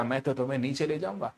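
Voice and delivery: lightly voiced, low, soft threat; voice of weight. Teacher plays weight speaking to the box, low hushed voice. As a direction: drop low and quiet as a personified force. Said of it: "भार क्या कहेगा".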